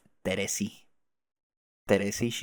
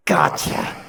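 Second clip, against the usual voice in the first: loud, low burst; sudden hoarse exclamation; rough gotcha shout; 8 semitones lower, 7 dB louder than usual. Acted. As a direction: pounce on the word. Acted pounce in scene.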